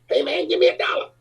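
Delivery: high-pitched pleading little guy; put-on high voice of a tiny panhandler; tiny man's voice. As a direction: beg in a small, high voice. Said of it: "just a tiny little midget. He goes".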